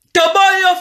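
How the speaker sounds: put-on to boyo called out high and loud. Shown as a demonstration. Vlogger calling out to Fally, loud call. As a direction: shout to boyo at him.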